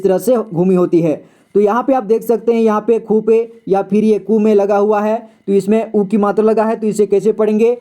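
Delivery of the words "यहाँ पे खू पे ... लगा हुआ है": ordinary delivery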